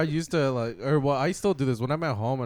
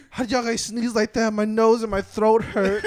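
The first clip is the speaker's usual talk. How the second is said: mock whiny complaint; 8 semitones higher, 4 dB louder than usual. Girlfriend voiced in story.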